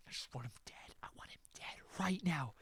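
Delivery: hushed, breathy, murderous; seething whisper. Real, not acted. Wanting Neji dead, a seething whisper. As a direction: whisper it with menace. Seething aside after shouting.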